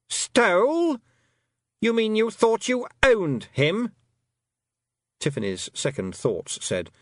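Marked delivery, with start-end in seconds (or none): 0.1-3.9 shrill high scorn, loud on Stole; incredulous queen. A narrator's reading. A fairy queen scoffing at the girl, loud and high. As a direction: scoff at the very idea.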